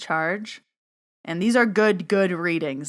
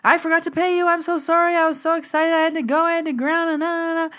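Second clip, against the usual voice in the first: much higher, louder, rushed and gushing; breathless excited apology; 11 semitones higher, 5 dB louder than usual. Retold or imitated. Client bursting in to pay Steph, high frantic gush. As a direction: play the client bursting back in, frantic and sorry. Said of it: "He's like".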